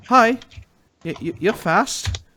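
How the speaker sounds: put-on high, loud, stammering kid; Chirpy. Chirpy greeting Giuseppe at the door, high stammer. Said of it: "and he says".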